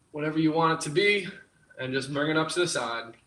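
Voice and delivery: ordinary talk, nothing marked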